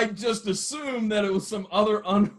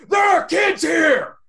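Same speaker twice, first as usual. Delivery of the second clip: slow, high shouted warning; slow furious shout, much higher and louder; retold angry yell; 9 semitones higher, 4 dB louder, slower than usual. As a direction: bellow it across the arena. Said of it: "and he yells".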